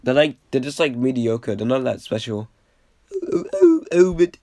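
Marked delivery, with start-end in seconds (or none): 3.3-4.4 failed squeaky put-on voice, loud; high-pitched voice. Vlogger too sick for the bit, attempting a high-pitched voice. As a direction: strain up into a very high mock voice. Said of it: "I can't do my high-pitched voice".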